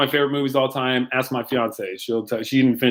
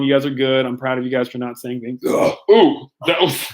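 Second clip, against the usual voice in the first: normal talking voice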